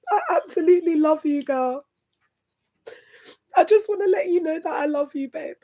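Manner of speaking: high, tearful declaration of love; real crying, pitched high; emotional. Real, not acted. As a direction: tell a friend you love them through tears. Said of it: "I'm so emotional".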